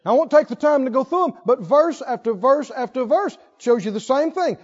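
ordinary talk, nothing marked

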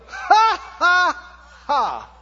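loud, high, deliberate "ha, ha, ha"; hearty staged guffaw; put-on belly laugh. Shown as a demonstration. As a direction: throw out a big forced laugh. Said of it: "throw your head back and go".

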